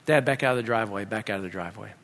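low, soft run through directions; subdued, orderly retelling; calm retry. Retold or imitated. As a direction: recite order and echo obediently.

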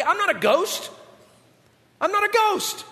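high-pitched, comic insistence; acted high-voiced denial; insistent protest. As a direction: protest it high, climbing on the repeat. Jesus reassuring frightened disciples.

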